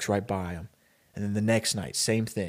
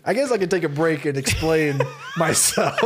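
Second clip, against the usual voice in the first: real laughter breaking up the words; laughing while speaking; 5 semitones higher than usual. Real, not acted. Storyteller pauses to explain his dark tale, laughing. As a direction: talk through giggles, raised pitch.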